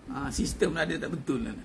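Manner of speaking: ordinary talk, nothing marked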